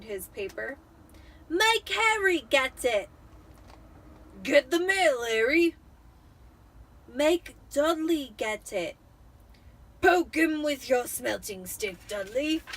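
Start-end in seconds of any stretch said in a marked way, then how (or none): 1.5-3.1 put-on higher, slow whiny boy voice; whiny spoiled Dudley. Dudley whines back. 4.4-5.8 louder, gruff fatherly order; bossy Uncle Vernon. Acted. 7.1-9.0 slow, a hint of a sulky boy; lightly voiced Harry. Harry answers back. 10.0-12.6 louder, gruff fatherly order; bossy Uncle Vernon. Acted. Vernon tells son.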